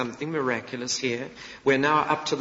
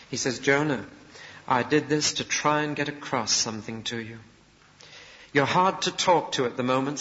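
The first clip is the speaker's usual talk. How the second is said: usual speaking voice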